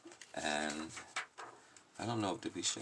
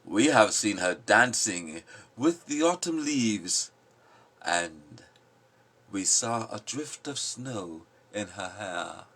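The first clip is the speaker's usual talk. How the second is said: louder, declaiming the lines; forceful poem reading; 4 semitones higher, 9 dB louder, slower than usual. Acted. Giving voice to the passionate in the poem, loud declaiming. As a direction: proclaim it like a storm. Reapers speak.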